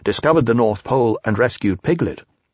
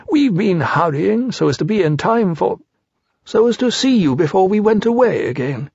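higher-pitched storybook bear, covering a slip; high, polite bear voice; Pooh, awkwardly polite; 8 semitones higher than usual. Acted. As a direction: play a well-meaning bear, pitched up.

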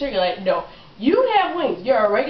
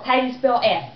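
usual speaking voice

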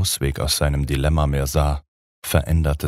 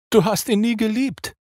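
much higher shrieked accusation; the blonde screaming. A narrator's reading. Blonde vampire screaming back at Dracula, shrieking. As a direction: scream the reproach back at him. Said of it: "schrie die Blonde".

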